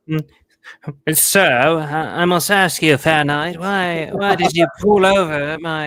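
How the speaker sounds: put-on loud, overly formal old-knight voice; mock knightly speech. Stopped driver mocking the cop as a courtly knight, loud and pompous. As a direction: ham up a courtly knight addressing an officer. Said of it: "He spoke exactly like that".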